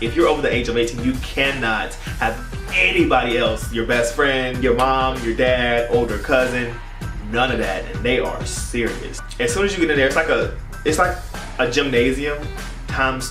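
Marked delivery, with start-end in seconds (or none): none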